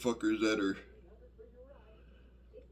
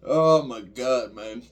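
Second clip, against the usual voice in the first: much louder, slow laughing oh my God; laughing groan; 10 dB louder, slower than usual. Real, not acted. To viewers, exasperated and laughing about the misspelled name. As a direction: let the laugh spill into the words.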